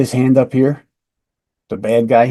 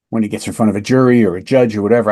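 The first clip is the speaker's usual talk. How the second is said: usual speaking voice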